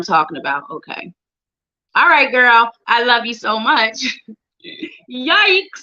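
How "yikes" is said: embarrassed laughter. Real, not acted. Embarrassed laugh at card meaning.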